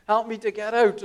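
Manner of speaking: high, loud repeated plea; shouting for help. Retold or imitated. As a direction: holler up from the pit for help. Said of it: "he shouts up to the psychologist".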